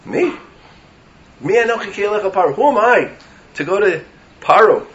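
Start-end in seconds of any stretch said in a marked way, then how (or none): 0.1-3.1 startled me. Acted. Moshe voiced answering Hashem.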